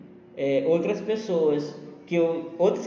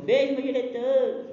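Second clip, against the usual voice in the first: high teasing call; 9 semitones higher than usual. Acted. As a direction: tease the director playfully. Teasing the director.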